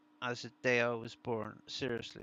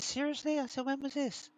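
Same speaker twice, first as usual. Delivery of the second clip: shocked disbelief; 14 semitones higher than usual. Retold or imitated. Retells own shocked reply.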